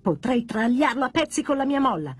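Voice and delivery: gleeful sneer. Acted. Jumping Jack offers to cut up the doll, gleeful. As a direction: relish the nasty plan. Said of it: "sogghignò entusiasta Jumping Jack".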